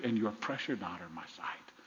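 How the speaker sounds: low, breath-soft reassurance; hushed tenderness. Acted. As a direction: sink into a near whisper. God's loving words to listeners.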